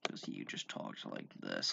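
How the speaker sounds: put-on very low, soft, creaky drunken mumble; slurred low croak of a mock drunk; drunk guy impression. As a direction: imitate a barely intelligible drunk who doesn't want to be there. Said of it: "that is what this guy sounded like".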